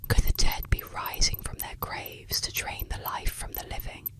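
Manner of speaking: ordinary delivery, nothing marked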